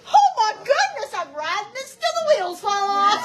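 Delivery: high, loud delighted gush with laughter; overjoyed at the easy money; high laughing delight; thrilled. Retold or imitated.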